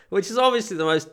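ordinary talk, nothing marked

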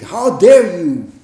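acted affront, leaning hard on the first words; indignant. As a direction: voice an offended onlooker's protest. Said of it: "we think".

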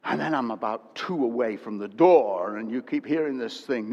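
normal talking voice

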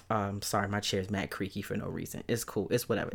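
usual speaking voice

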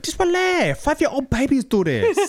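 squeaky mock doctor telling him to relax; mock Doctor Lee. Doctor Lee scolding the patient, high mock voice. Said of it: "he's like".

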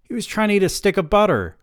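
husband sitting up to defend himself, exclaimed; exclaiming. A narrator's reading. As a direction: blurt it out defensively, high and quick. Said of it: "I exclaimed".

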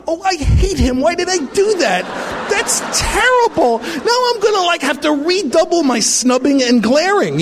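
high, hammed-up panic at his own friendliness; mock dismay. Acting out his horror after saying hi to a guy, high and hammed-up. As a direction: squeal it like a caught-out hater.

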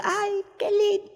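very high, slow cooing aunt voice. Retold or imitated. Aunt bathing her baby nephew, very high slow coo.

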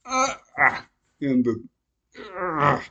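loud, high stretching groans. Real, not acted. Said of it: "stretching and groaning noises".

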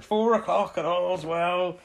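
drawn-out, loud, high Somerset cry; Somerset accent. Retold or imitated. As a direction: call it out slowly in broad Somerset. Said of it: "that was the Somerset accent".

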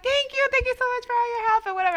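very high, sweet, fast gushing thanks; sugary thanks. Retold or imitated. Caller thanking the agent over-sweetly after being wrong. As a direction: lay the sweetness on thick.

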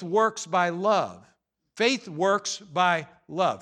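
"Faith works by love" spelled out slowly; slow, weighty scripture quote; slow emphatic quote. Shown as a demonstration. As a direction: drive the verse home word by word. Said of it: "Galatians chapter five and verse six says".

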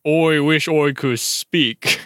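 slow "Oi, wish oi could speak", oi for I; slow mock accent with oi for I; strange accent. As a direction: read it in the girl's odd accent, slowly. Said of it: "she had said".

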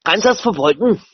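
high-pitched put-on German bark; mock German shouting. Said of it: "speak German so I can shout at people".

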